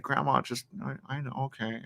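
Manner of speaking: very soft, helpless attempt to cut grandma off; unspoken thought. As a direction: mumble it as if only thinking it. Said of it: "in your head you do that".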